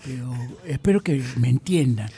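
put-on very old man with a foreign accent. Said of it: "Richard Lloyd ... ciento cincuenta y dos años".

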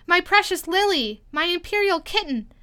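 high-pitched, doting royal cry. A narrator's reading. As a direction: cry out to a beloved child.

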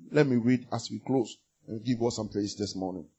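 ordinary talk

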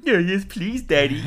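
high, giddy acceptance; mock childish excitement; eager childlike plea. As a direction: plead like a delighted little kid, laughing at the end. Acting out Homer's eager childlike answer.

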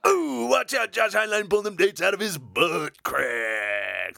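slow, hammed-up radio DJ voice; shock jock radio host. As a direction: boom it out like a trashy morning-show host. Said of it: "I kinda sounded like a shock jock".

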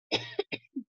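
sharp high cough; quick cough; coughing fit. Real, not acted. Cough during sermon.

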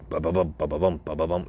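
usual speaking voice

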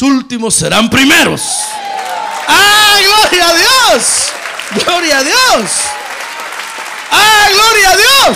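shouted praise, high and loud; preaching at full force. Real, not acted. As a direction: cry out the praise to the congregation.

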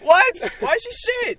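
very high, loud incredulous cry; real shock, high and loud; shocked disbelief. Real, not acted. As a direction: shriek it in disbelief. Shocked reaction to story.

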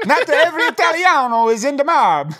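indignant mock Italian ghost; Italian accent. Ghost denies the mob in a hammed-up Italian accent. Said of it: "make me do an accent".

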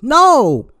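sharp high shouted refusal; loud no. Real, not acted. Refusing a money request.

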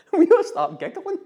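words broken by real laughter; laughing. Real, not acted. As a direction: giggle through the line. Laughing while retelling the giggle.

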